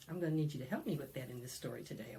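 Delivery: ordinary talk, nothing marked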